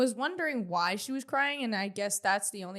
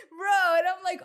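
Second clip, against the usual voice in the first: high laughing outburst, loud; laughing squeal; 10 semitones higher, 7 dB louder than usual. Real, not acted. Laughing while retelling the episode.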